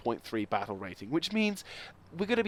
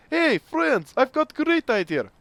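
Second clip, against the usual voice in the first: high, loud hammed-up Russian accent; terrible Russian accent; 12 semitones higher, 6 dB louder, slower than usual. A Soviet engineer pitching his plan, in a hammed-up Russian accent. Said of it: "Forgive the terrible Russian accent".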